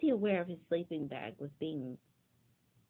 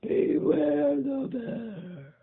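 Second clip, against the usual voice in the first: slow, loud, higher boom; slow booming bear call; 8 semitones higher, 7 dB louder, much slower than usual. Acted. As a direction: boom the warning across the camp. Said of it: "growly voice boomed out".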